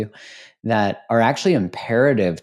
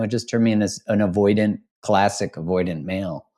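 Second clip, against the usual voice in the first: ordinary talk, nothing marked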